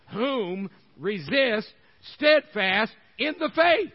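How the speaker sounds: loud, high preaching, slow on devours; full-force preaching. Acted. Preacher rallying the congregation to resist the devil, loud and high. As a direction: hammer each devours slowly and loud.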